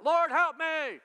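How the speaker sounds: very loud, high demonstration shout. Shown as a demonstration. Preacher showing listeners what shouting is like, shouting. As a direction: belt out the plea at full volume. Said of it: "You like it when I shout".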